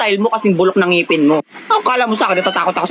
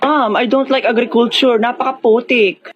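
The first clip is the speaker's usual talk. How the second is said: whiny student; 4 semitones higher, slower than usual. Acted. Student whining to teacher.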